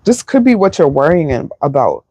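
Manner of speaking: normal talking voice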